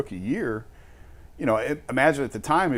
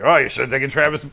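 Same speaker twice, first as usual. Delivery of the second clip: mock hindsight; 4 semitones higher, 7 dB louder than usual. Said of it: "it's easy to say".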